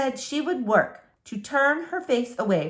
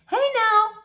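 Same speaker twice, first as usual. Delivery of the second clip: much higher, louder warning call; alarmed call; 13 semitones higher, 5 dB louder than usual. Acted. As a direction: Flora calling out at the window. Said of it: "said Flora".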